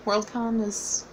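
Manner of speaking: ordinary talk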